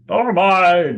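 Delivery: loud, high, drawn-out crowd cry; agreeing bystander. Acted. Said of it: "And others are agreeing".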